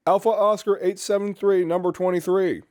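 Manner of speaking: drill sergeant roll call. Retold or imitated.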